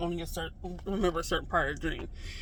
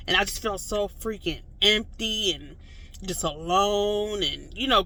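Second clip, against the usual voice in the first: ordinary delivery, nothing marked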